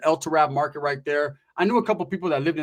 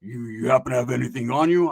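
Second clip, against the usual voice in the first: lower-pitched, serious request; more serious; 5 semitones lower than usual. Retold or imitated. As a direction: imitate a buddy asking carefully, hesitant pauses. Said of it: "He was a little bit more serious this time".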